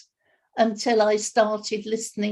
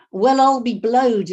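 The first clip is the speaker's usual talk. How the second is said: put-on savoured, louder old-fashioned exclamation "Well, I'll be blowed" with a falling close; relishing old phrase; 3 semitones higher, 7 dB louder than usual. Shown as a demonstration. Host delights in a childhood phrase, savouring it aloud. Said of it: "that's a marvelous one".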